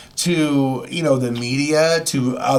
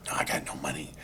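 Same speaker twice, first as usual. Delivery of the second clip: hushed whisper so nobody hears; 13 semitones lower, 14 dB quieter, faster than usual. Retold or imitated. Whispering to Clint about having no money. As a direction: whisper it across the table.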